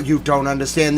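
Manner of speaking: ordinary talk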